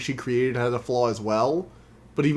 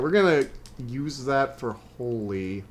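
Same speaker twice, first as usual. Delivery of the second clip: ordinary talk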